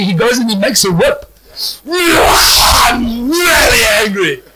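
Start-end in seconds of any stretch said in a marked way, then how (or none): 1.9-4.4 mock angry Jesus. Acting out Jesus with whip.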